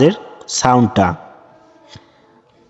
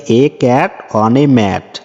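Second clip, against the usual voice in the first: very slow, careful English reading; slow read-aloud; much slower than usual. Shown as a demonstration. Teacher reading the textbook instruction slowly to pupils. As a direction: spell it out slowly for the class.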